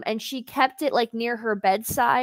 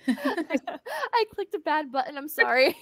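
high, giggly admission; real giggling while owning up; laughing; 6 semitones higher, slower than usual. Real, not acted. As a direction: laugh through the words. Giggling apology after wrong click.

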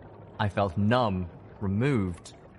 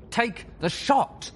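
growled; 14 semitones higher, slower than usual. A narrator's reading. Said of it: "Autumn Leaf growled".

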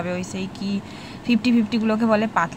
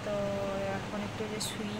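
speaking softly; 12 dB quieter than usual. Real, not acted. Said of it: "আমাকে একটু আস্তে আস্তে কথা বলতে হচ্ছে". (ঘুমন্ত বাচ্চার পাশে খুব আস্তে কথা বলা)

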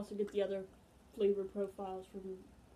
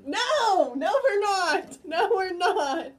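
shouting; 9 semitones higher, 10 dB louder, much slower than usual. Real, not acted. Denying sponsorship loudly.

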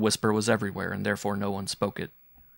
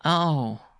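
put-on higher, louder child's exclamation; young boy; 7 semitones higher, 6 dB louder than usual. A narrator's reading. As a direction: react as a little boy. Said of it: "said the boy".

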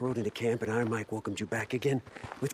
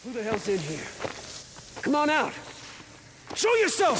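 yelling at a hidden enemy; calling out; 12 semitones higher than usual. Acted. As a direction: shout it into the darkness. Calling into dark room.

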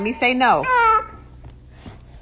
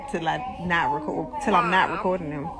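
ordinary talk, nothing marked

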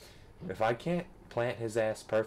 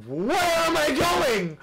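drawn-out high yell, much louder; dismayed yell; 5 dB louder, much slower than usual. Real, not acted. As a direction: wail it slowly at the top of your voice. Fell, yelling in dismay.

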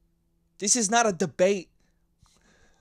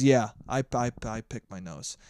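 mild imitation of the ex, lower and much softer, mumbled admission; a touch of a bashful ex owning up; sheepish confession; 9 semitones lower, 5 dB quieter than usual. The ex finally admits it to him, quietly and sheepishly. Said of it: "she goes".

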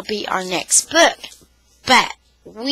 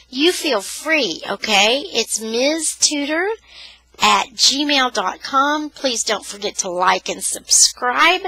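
ordinary delivery, nothing marked

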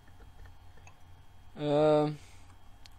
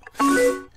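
high giggle; 7 dB louder than usual. Acted. Laugh after cheering.

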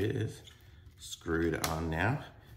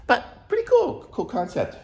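excited; 9 dB louder than usual. Real, not acted. Excited praise.

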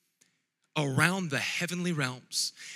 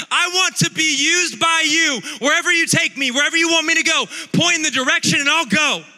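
much higher and louder, building shout; preaching at full force; 9 semitones higher, 9 dB louder than usual. Acted. Pastor rallying the congregation, a loud building preaching shout. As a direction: rise to a fiery preaching climax.

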